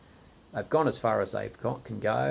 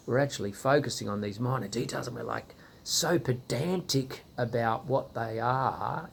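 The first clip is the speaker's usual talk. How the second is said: ordinary delivery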